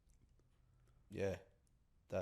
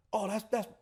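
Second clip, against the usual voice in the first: excited impression; 13 semitones higher, 4 dB louder than usual. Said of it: "and he's like".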